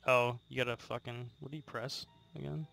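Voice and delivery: usual speaking voice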